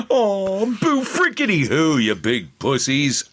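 Duke coming out. Acted. Taunting voice actors as Duke, high and slow mocking. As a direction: jeer at them like a cocky action hero. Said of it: "that's Duke coming out again".

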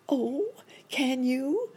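high, eager question; high-pitched monkey doctor, full of hope; hopeful cry. A narrator's reading. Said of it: "cried Doctor Monkey Doodle".

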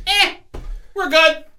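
high-pitched, careless dismissal; mock complacency. Host mimicking the Cowboys up seventeen, mock complacency. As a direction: shrug it off in a squeaky put-on voice.

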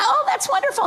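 very high, sugary mock delight; fake politeness. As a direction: say it through a fake smile. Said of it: "with a fake smile".

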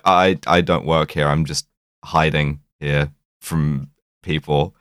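low, halting, awkward retelling; mildly awkward, stumbling and slowing down; imitated awkwardness. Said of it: "like, awkwardness quite well".

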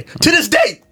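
high-pitched indignant yell; exasperated outburst. Acted. Storyteller venting about containers that never came, exasperated yell. Repeats it as outburst, then apologises.

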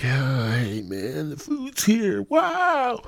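high, airy mock stoner girl at the door; stoned woman at door. Retelling the delivery girl opening the door, airy and high. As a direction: play the spacey Rastafarian chick. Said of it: "She answers the door and she's like".

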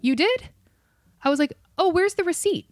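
high, fake-innocent surprise; mock surprise. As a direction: feign shocked innocence.

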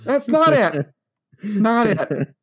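high-pitched comic disbelief; mock incredulity. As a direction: squeal it in hammed-up amazement. Teasing wife over her wrong answer.

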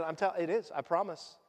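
ordinary delivery

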